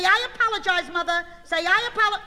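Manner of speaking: put-on high, loud prompting of a child; insistent scolding. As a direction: drill the apology into the kid, loudly. Dictates apology for child to repeat.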